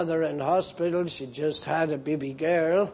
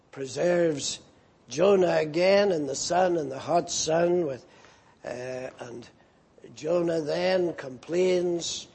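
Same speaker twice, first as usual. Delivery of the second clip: normal talking voice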